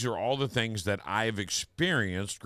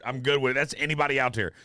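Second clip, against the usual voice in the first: ordinary talk, nothing marked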